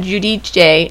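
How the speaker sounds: Giudice said "joo-dee-chay"; three syllables in Giudice, ending "chay"; later pronunciation. Shown as a demonstration. Host tracing the name change, Giudice as "joo-dee-chay". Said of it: "Then they started saying".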